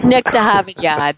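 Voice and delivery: put-on echoing a Boston accent, r dropped. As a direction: mimic the Boston accent back. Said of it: "my Boston accent will come out".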